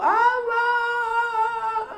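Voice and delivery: "Abang" sung out high as a hammed-up wail; mock wail. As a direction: overplay a grieving cry of "Abang". Mocking widow's grave lament.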